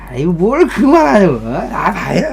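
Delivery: put-on drunk coworker brushing off being told to stop; drunk overdoing it. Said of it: "오버하다가 막 이래".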